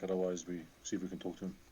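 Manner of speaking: usual speaking voice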